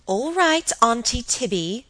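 high singing-out reply; calling out. A narrator's reading. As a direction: call back to the other room, drawing out the name. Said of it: "called Helen".

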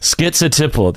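"schizotypal" with a clear skit-suh. Shown as a demonstration. Teacher giving her preferred T version. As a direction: keep the T in "schizotypal". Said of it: "there's a T".

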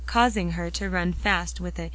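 ordinary delivery, nothing marked

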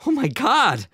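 loud, high-pitched outcry; shocked shout. A narrator's reading. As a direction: shout it out in alarm. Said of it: "Albert shouted".

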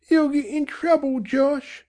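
high, whimpering mother; tearful mother. A narrator's reading. Mother begging husband to stop, tearful. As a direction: plead through tears.

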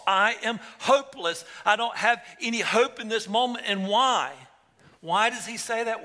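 high, loud, impassioned preaching; fervent preacher voicing Isaiah's woe; preaching at full force. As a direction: cry out Isaiah's despair to the congregation.

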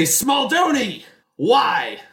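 high, slow, then a short shout of why; anguished cry. Acted. Justino crying out at Smaldoni, betrayed. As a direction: call out betrayed. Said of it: "he's gonna say".

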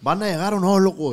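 calling out. Real, not acted. Calling out to friends arriving.

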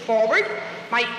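calling commands. Real, not acted. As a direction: coax the dog along. Trainer calling heel to dog.

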